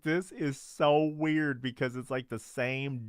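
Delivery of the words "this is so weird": slow, higher, louder disbelief; drawn-out "this is so weird" reaction; amused disbelief. Retold or imitated. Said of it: "I was like".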